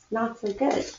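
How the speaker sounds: plain reading voice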